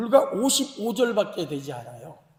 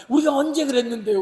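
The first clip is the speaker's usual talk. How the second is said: loud, higher-pitched mock protest; argumentative retort; 6 semitones higher, 6 dB louder than usual. Israelites answering God back in a dispute, indignant. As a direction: push back as if wrongly accused. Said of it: "크게 여섯 가지 논쟁이 벌어집니다".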